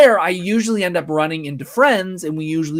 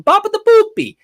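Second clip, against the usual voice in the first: exaggerated, high mock-Italian accent; bad Italian accent; 8 dB louder than usual. Shown as a demonstration. Admitting his Luigi is poor, jokey bad Italian accent. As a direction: do a hammy, badly faked Italian accent. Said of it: "very bad Italian accent when I do Luigi".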